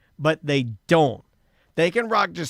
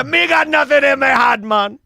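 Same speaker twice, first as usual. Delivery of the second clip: higher, louder Jamaican patois demo; Jamaican accent; 7 semitones higher, 5 dB louder than usual. Shown as a demonstration.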